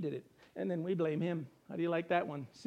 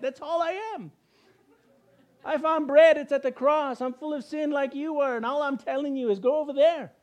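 higher and louder, full pulpit fervor; fervent preaching; 5 semitones higher, 4 dB louder than usual. Real, not acted. Preacher tells the congregation where he found bread, fervently. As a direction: proclaim it, rising to the climax.

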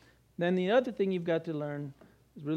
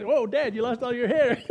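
put-on teasing kids; 4 semitones higher, 4 dB louder than usual. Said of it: "they look at me and they say".

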